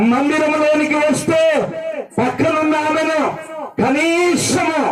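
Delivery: high preacher's cry, stretched at the end; forceful preaching. Real, not acted. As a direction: preach it high, drawing out the last word.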